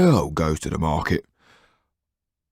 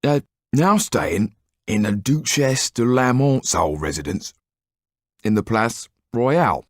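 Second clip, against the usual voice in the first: halting, higher talk round a mouthful; speaking with mouth full; 7 semitones higher than usual. Acted. Didier reporting on the Marquis, mouth full of bread. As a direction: talk through a bite of bread. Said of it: "speak with his mouth full".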